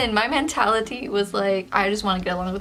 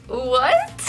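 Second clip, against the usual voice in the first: high, louder startled what; a jolt of disbelief; sudden incredulous question; shocked what; 4 semitones higher, 4 dB louder than usual. Retold or imitated. Shocked reaction in retold scene.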